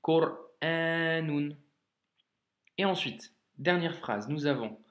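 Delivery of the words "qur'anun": Qur'anun, slow, the A held long. Shown as a demonstration. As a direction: draw out the A of Qur'anun. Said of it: "c'est un A long". (Qur'anun, A long bien tenu)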